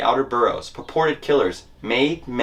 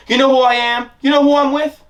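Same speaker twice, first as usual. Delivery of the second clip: high, loud mobster bark down the phone; yelling into the phone; 13 semitones higher, 5 dB louder than usual. Retold or imitated. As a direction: yell it like a boss threatening a supplier. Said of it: "fond of yelling into the phone".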